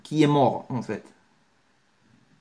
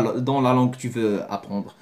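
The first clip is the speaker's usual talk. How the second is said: normal talking voice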